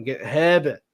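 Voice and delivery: Herbert said Boston style as hebbet, no r sounds; a light Boston accent dropping the r in Herbert; Bostonian accent. Shown as a demonstration. Streamer saying Herbert in a light Boston accent. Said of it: "I like to say Herbert in a Bostonian accent".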